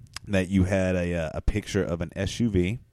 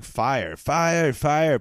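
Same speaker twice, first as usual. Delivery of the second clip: raised, higher mock shout of alarm; retold yell; 7 semitones higher, 4 dB louder, much slower than usual. Podcaster retelling Frankenstein's fire rescue, imitated yelling. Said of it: "He's yelling".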